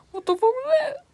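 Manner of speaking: high, loud panicked outburst; scared. Acted. Scared at the sudden British accent, high and panicked. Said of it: "I'm scared because".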